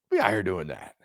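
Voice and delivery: usual speaking voice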